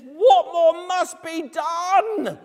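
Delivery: high, loud, slow outraged king's demand; furious king. Acted. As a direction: thunder it at the man you think attacks your queen. Furious king confronts Haman.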